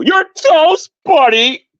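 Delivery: high mock imitation of her taunt; mocking her catchphrase. Imitating a rival's corny catchphrase, high and mocking. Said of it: "That's what she likes to do".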